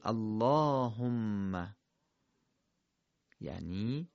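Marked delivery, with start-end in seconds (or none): 0.0-1.8 اللهم with a long ā after the lam: allāhumma; correct recitation. Shown as a demonstration. Teacher demonstrating the correct reading. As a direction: draw out the ā in اللهم. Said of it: "باید بخونیم".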